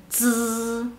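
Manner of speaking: "z" as a held, buzzing dz with no puff of air; Mandarin Z. Shown as a demonstration. Teacher showing learners how Z sounds alone, "z" as a vibrating dz. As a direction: say "z" like the end of English kids, drawn out. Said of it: "hay una pequeña vibración".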